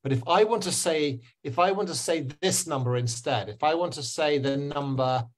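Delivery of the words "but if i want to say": ordinary delivery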